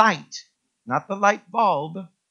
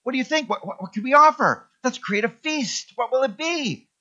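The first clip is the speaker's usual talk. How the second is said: higher, flustered chatter of eager animals; excited farm animals; 7 semitones higher than usual. Acted. Pastor voicing the farm animals for the congregation, flustered and higher. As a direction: play the animals all abuzz planning a feast. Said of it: "All the farm animals began to get together and said".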